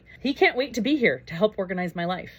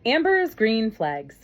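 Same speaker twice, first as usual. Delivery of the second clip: announcing title; 4 semitones higher, 5 dB louder, much slower than usual. Real, not acted.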